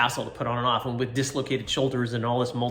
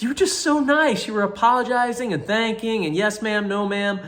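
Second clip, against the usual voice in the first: gushing nurse; 10 semitones higher, 6 dB louder than usual. Retold or imitated. Imitating the nurse gushing over how polite he was. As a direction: coo like a delighted nurse.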